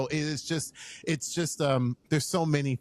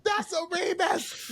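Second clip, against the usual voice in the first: high-pitched retold cheer for a friend; excited shouting. Friend reliving seeing his name on the credit, excited shouting. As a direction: yell it out, thrilled, way up high.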